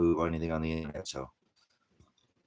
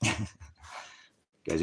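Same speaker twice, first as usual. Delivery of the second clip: usual speaking voice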